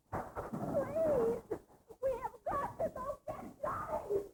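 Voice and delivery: high tearful begging; pleading with the man to save her brother; desperate pleading. Acted. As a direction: beg him through sobs. Begging to go get Johnny.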